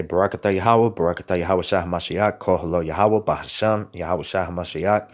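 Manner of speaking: solemn chanted-feeling prayer close; closing blessing. Acted. Closing blessing in Hebrew-style words.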